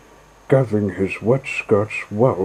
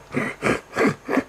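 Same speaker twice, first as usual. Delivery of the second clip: breathy jeering chuckle; mocking laugh. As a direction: laugh at him. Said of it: "still laughing".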